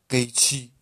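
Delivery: stubborn storybook shout, kept small; narrated cry. A narrator's reading. Younger brother surfacing from the river to insist, mild defiant cry. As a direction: call it out defiantly, without full volume. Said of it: "فریاد زد".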